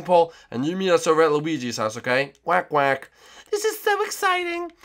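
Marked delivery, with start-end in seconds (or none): 3.5-4.7 put-on high sweet girly voice; Birdo voice. To Yoshi in the morning, high sweet Birdo voice. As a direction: pitch way up as a sweet girlfriend.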